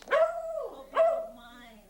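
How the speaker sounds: high and drawn-out; high, slow, sing-song. Real, not acted. Reading card aloud.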